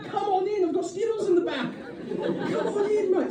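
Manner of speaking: high, soft, eager invitation; excited driver. Retold or imitated. Driver inviting passenger into the back, excited. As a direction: coax them in with high-pitched excitement. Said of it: "He was excited".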